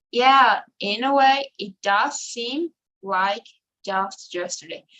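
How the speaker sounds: slow, with weight on "does"; emphasis demo. Shown as a demonstration. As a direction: lean on "does" to show emphasis. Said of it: "when we want to emphasize".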